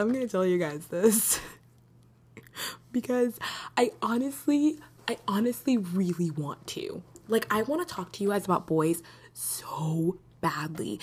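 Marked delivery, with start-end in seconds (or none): 5.1-11.0 hushed, breathless whisper; real conspiratorial whisper to the listeners. Real, not acted. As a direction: confess it under your breath like a secret. Confiding whisper about boys.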